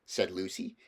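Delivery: ordinary delivery, nothing marked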